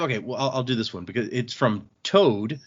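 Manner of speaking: usual speaking voice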